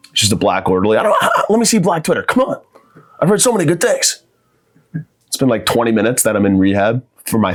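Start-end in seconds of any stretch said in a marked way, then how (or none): 1.0-4.2 higher, whiny pleading for the phone; begging addict. Acted. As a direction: beg the orderly like a desperate addict. Begging the orderly in rehab.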